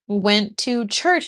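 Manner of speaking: normal talking voice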